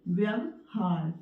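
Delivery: व्यवहार said slowly, syllable by syllable; drawn-out व्यवहार for dictation; teacher reading a word for writing; slow and clear. Shown as a demonstration. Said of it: "तो इस तरह से लिखा जाता है".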